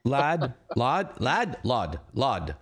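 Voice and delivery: warming up a brogue on one word; practising Irish accent. Shown as a demonstration. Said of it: "what's the Irish accent sound like".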